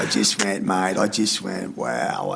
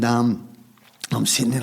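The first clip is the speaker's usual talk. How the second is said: usual speaking voice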